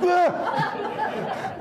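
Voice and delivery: high laughing mock shriek; a jokey jumped-out-of-skin squeal; mock startled yelp with a laugh. Preacher fakes fright at God's voice, laughing.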